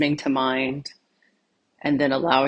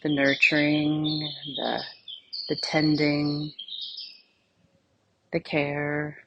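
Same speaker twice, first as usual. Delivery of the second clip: soft, unhurried meditation voice with long pauses; guided meditation; 7 dB quieter than usual. Acted. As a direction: gently lead them inward, phrase by phrase, leaving silence between. Leads a guided meditation exercise.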